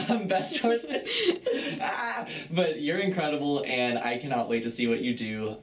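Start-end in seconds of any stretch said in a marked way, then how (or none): 0.1-1.0 laughing. Real, not acted. Laughs at her own word slip. 1.8-3.6 laughing. Real, not acted. Laughing after her word slip.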